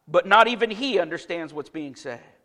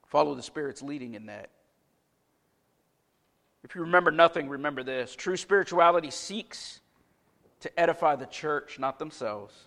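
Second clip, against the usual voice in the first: softer, lower closing plea; quiet exhortation; 5 semitones lower, 6 dB quieter than usual. Acted. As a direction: send them off sincerely.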